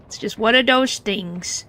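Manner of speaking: ordinary talk, nothing marked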